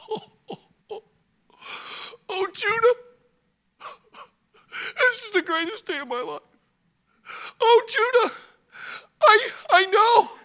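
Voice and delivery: put-on high, crying old father overjoyed; joyful sobbing. Father Jacob crying with joy at son's news.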